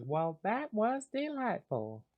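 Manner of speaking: a gracious Southern lady after a win; Luna's southern accent. Acted. Luna remarks on the match in a Southern accent. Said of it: "Luna says".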